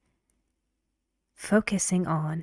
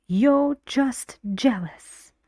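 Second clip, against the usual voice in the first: boos, traag en met nadruk: "You're just jealous."; angry stress demo; 5 semitones higher, 4 dB louder than usual. Shown as a demonstration. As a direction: demonstrate the angry line with heavy stress on "You're". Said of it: "And she also, when gets angry".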